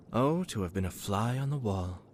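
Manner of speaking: ordinary talk, nothing marked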